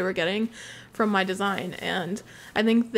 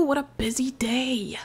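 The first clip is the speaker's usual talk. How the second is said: soft whispered aside; 2 semitones higher, 3 dB quieter than usual. Real, not acted. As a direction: breathe it out unvoiced.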